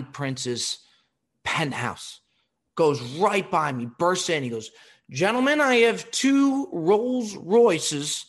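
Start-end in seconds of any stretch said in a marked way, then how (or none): 5.1-8.3 loud, high showman's pitch, slow on the first lines; bellowing wine presentation; booming announcement. Retold or imitated. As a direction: announce the wines like a ringmaster to the room. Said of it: "And he's so fucking loud".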